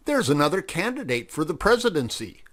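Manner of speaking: a hint of a sly fox. Fox John's dialogue.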